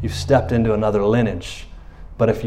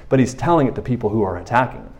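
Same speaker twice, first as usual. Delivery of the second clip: ordinary talk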